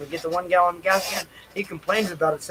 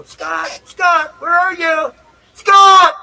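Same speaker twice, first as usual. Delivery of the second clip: loud, high calls for a lost friend; searching and shouting for a friend; calling out; 10 semitones higher, 10 dB louder, much slower than usual. Retold or imitated. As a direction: yell his name across the lot.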